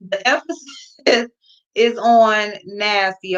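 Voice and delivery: slow, with the weight landing on nasty; stress on nasty. Shown as a demonstration. Viewer repeating the line to her audience, slowly stressing nasty. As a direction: lean slowly into the word nasty. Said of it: "the emphasis on nasty".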